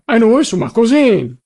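high, loud dialect insistence; insistent Turinese relative. A narrator's reading. Visitor concluding that they are cousins, insisting in Turinese.

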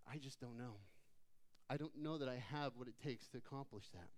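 low, soft, mock-discouraged voice; voiced self-doubt. Believers tormented by waves doubt themselves, quietly voiced discouragement. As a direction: sink into quiet doubt, voicing the worn-down believer.

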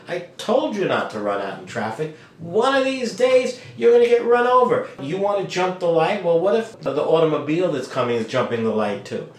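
scolding parent, pitch jumping high; exasperated parental telling-off; parent correcting child. Acted. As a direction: warn a child sternly about danger. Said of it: "like a parent correcting their child".

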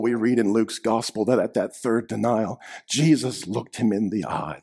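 ordinary delivery

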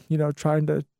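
ordinary delivery

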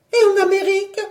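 very high, loud query; high-pitched persistent wife; insistent questioning. Acted. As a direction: press him with a high challenge. Wife fires off another destination.

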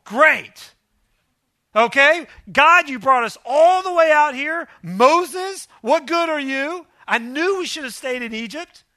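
high, loud, sarcastic complaining people; mock-whiny Israelites griping at Moses; whiny grumbling crowd. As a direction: whine at Moses like a fed-up mob. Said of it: "the people are like".

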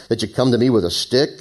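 ordinary talk, nothing marked

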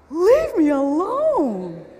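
loud, drawn-out snap at a pest; exasperated outburst. Acted. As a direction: cry it out high and slow, fed up. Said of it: "just want to turn and go".

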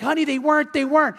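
calm him down, begging; wife pleading with jealous husband. Retold or imitated.

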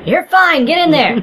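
high, loud push forward; loud urging. Real, not acted. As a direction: shove them in with a shout. Urging teammate into the fight.

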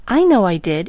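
ordinary delivery

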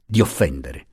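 usual speaking voice

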